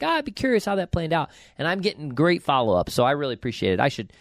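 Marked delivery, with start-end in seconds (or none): 0.0-1.3 higher-pitched, offhand throwaway remark; flippant. Retold or imitated. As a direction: toss it off lightly, pitched up. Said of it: "I say that flippantly".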